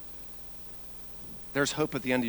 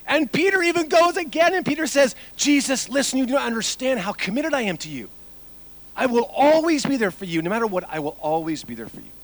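loud, high preaching at full force; 12 semitones higher, 10 dB louder than usual. Acted. Pastor retelling Peter to the congregation, loud preaching. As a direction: preach it out to the whole room.

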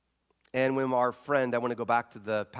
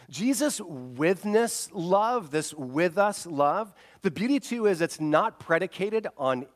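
slow emphatic preaching; 7 semitones higher, 5 dB louder, slower than usual. Acted. Preacher slows to savour words.

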